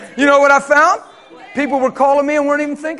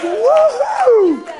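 high, bright cheer; excited whoop; 8 semitones higher than usual. Retold or imitated. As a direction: whoop with joy. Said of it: "I was like".

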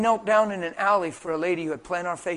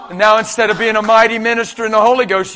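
full-force preaching; 4 semitones higher, 10 dB louder than usual. Real, not acted. Preacher warns congregation about insecurity and identity crisis, forceful preaching. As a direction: press hard on the congregation, stretching the last words.

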